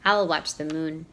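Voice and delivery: ordinary delivery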